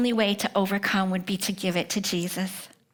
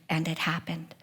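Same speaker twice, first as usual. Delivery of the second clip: usual speaking voice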